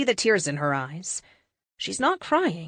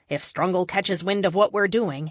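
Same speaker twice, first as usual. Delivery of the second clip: ordinary delivery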